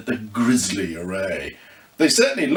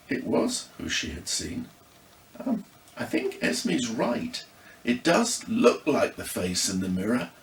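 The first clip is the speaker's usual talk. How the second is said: usual speaking voice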